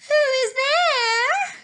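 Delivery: put-on high, slow, quavering old lady voice; a frail granny calling through the door; Grandmother. Grandmother answering the knock, high and slow. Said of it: "said Grandmother".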